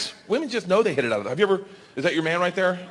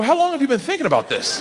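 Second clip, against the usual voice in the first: pitched way up in disbelief; a dad stunned by his kid's plan; mock amazed; 9 semitones higher than usual. Dad amazed at daughter's scheming, high incredulous question.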